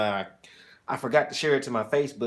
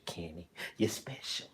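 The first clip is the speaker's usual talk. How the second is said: whisper; 6 semitones lower, 13 dB quieter than usual. Real, not acted. Teasing aside to Kenny.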